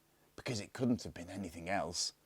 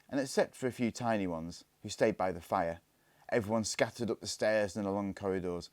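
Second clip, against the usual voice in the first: ordinary delivery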